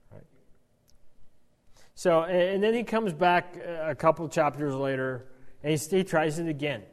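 usual speaking voice

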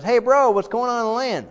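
asked high and bright with eagerness; a brother calling out high with eagerness; pitched high, eager call from brother to brother; high, eager question. Retold or imitated. Nehemiah asks his brother.

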